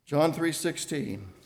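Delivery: normal talking voice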